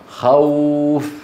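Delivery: خوف with the "aw" glide held very long; leen letter waw. Shown as a demonstration. Teacher demonstrates waw after fatha, long leen glide. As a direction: draw out the w of خوف on a level tone. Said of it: "هذا اسمه حرف لين".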